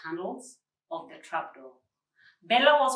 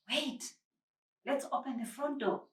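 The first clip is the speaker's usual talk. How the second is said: softer, hushed plea to Josephine's friend; whispered; 5 semitones higher, 6 dB quieter than usual. A narrator's reading. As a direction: keep it hushed so nobody upstairs hears. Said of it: "whispered Josephine".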